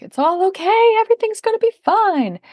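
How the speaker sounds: high, singsong mock reassurance; glossing it over. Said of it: "gloss it over, like".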